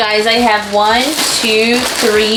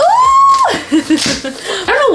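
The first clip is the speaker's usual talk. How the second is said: laughing; much slower than usual. Real, not acted. Laughing at her own squeal.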